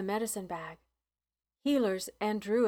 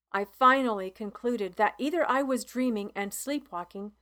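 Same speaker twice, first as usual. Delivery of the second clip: plain reading voice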